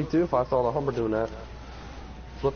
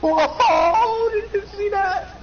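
very high, loud squeal of amazement; amazed shout; 5 dB louder, much slower than usual. Real, not acted. Player to teammate after a stunt, amazed shout. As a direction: shriek it in real astonishment.

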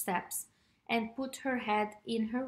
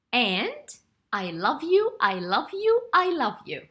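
affirming; 5 dB louder than usual. Real, not acted. Host affirming the children.

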